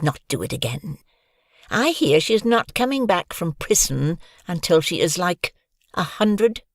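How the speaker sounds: lightly voiced sneering schoolboy; hurtful bully taunt. Bully Pickle mocking Hoggy's mother, sneering. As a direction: jab at her, savouring the cruelty. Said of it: "say something very hurtful".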